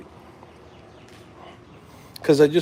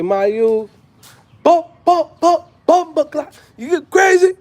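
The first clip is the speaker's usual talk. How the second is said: high, loud Jamaican patois voice; Jamaican accent; 13 semitones higher, 7 dB louder, slower than usual. Pretend. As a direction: play a hyped Jamaican youth. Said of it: "when I go to Jamaica".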